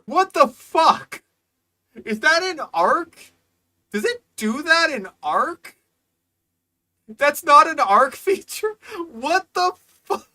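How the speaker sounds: loud, high, disbelieving outburst; incredulous shouting. Real, not acted. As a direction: yell in total disbelief. Incredulous at the tickle feature.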